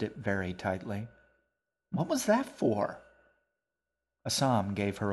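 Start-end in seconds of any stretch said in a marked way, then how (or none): 1.9-3.0 put-on high, indignant girl's voice. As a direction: protest like a wronged little sister. Girl protests to brother.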